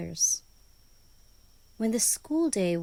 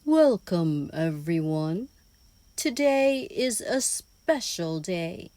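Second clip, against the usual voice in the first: put-on louder, welcoming old owl teacher; wise old owl; 3 semitones lower, 5 dB louder than usual. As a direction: greet the whole class like a kind old owl teacher. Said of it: "The wise old owl smiled kindly and said".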